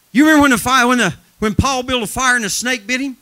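loud, driving revival preacher; fired-up pulpit shout; full-force preaching. Real, not acted. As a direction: preach it hot, voice pushed high and loud.

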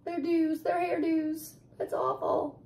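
high, soft crying lament; softly whimpering over the ruined hair. Acted. Reader repeats the mother's lament.

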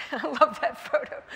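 laughing while speaking, then a high laugh. Real, not acted. Looking at a photo of John, laughing fondly.

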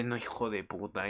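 low, soft disgusted curse; quiet appalled swear; muttered curse. Retold or imitated. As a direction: mutter it under the breath. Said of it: "Y yo en plan de".

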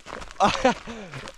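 high laugh after an exclamation; real laugh. Real, not acted. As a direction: burst out laughing, high-pitched.

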